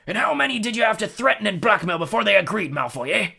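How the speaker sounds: high, loud accusing roar. A narrator's reading. Hagrid accuses Malfoy of threatening governors, roaring. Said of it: "he roared".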